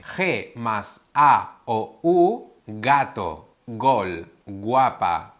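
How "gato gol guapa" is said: "gato", "gol", "guapa" with a soft, light g; careful teacher demo of soft g. Shown as a demonstration. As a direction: model the gentle g on each word. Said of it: "este sonido es muy suave".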